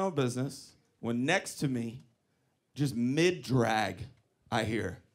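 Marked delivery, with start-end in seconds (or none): none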